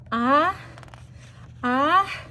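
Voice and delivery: rising pre-sneeze gasps; ah ah before a sneeze; building sneeze. Acted. Elephant winding up to sneeze, gasping ah.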